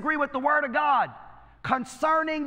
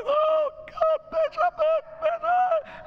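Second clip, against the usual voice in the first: high wailing cry, hammed up; mock wailing; slower than usual. Imitating a crying church member wailing to the bishop, hammed up. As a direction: wail his name like a sobbing member.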